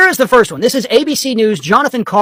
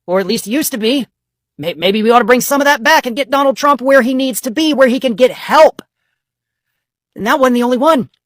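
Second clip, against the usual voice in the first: ordinary delivery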